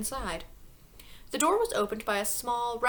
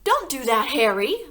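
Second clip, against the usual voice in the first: loud, higher protesting cry; cried out; 4 semitones higher, 9 dB louder than usual. A narrator's reading. Wife protesting to her husband over the TV, loud cry. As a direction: wail at him to leave the telly. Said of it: "Missus Wormwood cried out".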